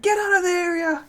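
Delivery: long, high-pitched pretend yell; imitated yelling. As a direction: imitate officers calling from a distance. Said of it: "They were yelling".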